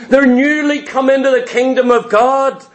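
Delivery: ordinary talk, nothing marked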